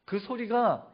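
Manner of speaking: high, loud sermon climax; impassioned preaching. Real, not acted. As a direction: proclaim it forcefully to the whole congregation. Preaches the climax at full force.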